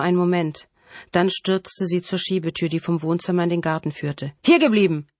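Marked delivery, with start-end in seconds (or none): 4.4-5.1 sharp raised call to halt; commanding shout. A narrator's reading. Killer orders the fleeing girl to stay, commanding shout. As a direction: call out a loud, higher order. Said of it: "rief Colin Friendly".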